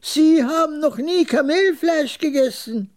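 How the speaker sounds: very high, mocking repeat; high-pitched scorn, no laugh; scornful old trader. A narrator's reading. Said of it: "höhnisches, etwas dünnes Gelächter aus und wiederholte".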